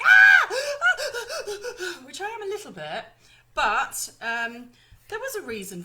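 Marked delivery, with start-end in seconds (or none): 0.0-0.5 loud high mock shriek of panic; hammed-up scream at being late; panicked scream. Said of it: "I would be like". 0.5-2.0 gasp. Retold or imitated. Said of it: "I would be like".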